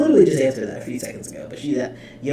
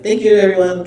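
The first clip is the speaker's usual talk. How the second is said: loud, raised mock sign-off; imitated fed-up thanks; 5 semitones higher, 9 dB louder, faster than usual. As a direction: imitate someone so done, wrapping up loudly. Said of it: "She's so done".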